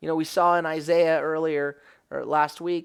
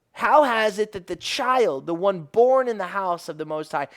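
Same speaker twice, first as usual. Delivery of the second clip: ordinary talk, nothing marked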